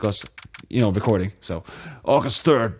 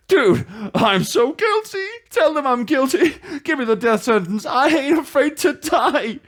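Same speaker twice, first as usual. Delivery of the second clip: put-on wailing despairing boyfriend; 13 semitones higher than usual. Grieving client wailing in the lobby, hammy high crying. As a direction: wail it like a melodramatic wreck. Voices Larry.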